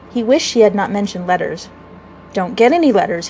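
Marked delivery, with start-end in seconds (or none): none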